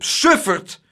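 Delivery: high, loud panicked tirade; frantic outraged yelling; shouted. A narrator's reading. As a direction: berate him in rising panic. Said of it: "schreeuwde meneer Tump".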